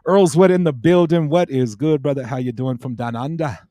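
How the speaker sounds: hammed-up Australian accent, big opening shout-out; attempted Australian accent. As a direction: hail a mate in a put-on Australian accent. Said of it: "Hopefully my accent isn't terrible".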